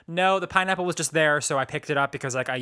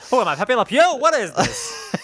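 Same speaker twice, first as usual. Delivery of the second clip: louder, higher mock New Yorker; hammed-up New York accent; 7 semitones higher, 5 dB louder than usual. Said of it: "You got real New York all of a sudden".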